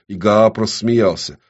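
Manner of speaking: usual speaking voice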